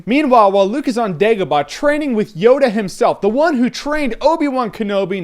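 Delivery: ordinary delivery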